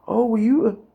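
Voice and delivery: normal talking voice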